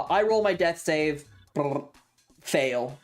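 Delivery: normal talking voice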